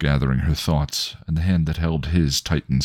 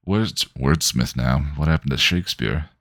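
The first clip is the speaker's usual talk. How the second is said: plain reading voice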